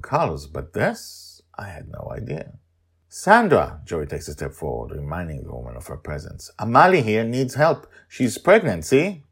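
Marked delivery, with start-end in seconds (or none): 3.1-3.8 put-on higher, louder child's call; child Joy calling out. Joy calls out. 6.6-9.2 put-on higher, louder child's call; child Joy calling out. Joy pleads for Amali.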